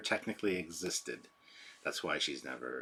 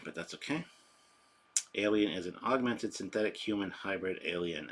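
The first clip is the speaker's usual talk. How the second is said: ordinary talk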